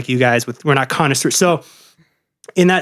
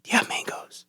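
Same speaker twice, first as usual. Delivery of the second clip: whisper; 12 dB quieter than usual. Retold or imitated. Mocking a customer's drink order.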